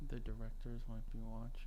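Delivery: very low, hushed warning; whispering. Pretend. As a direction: whisper it as if being watched. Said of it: "stop whispering".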